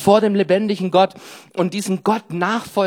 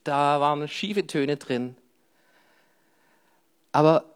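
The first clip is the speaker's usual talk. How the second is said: ordinary talk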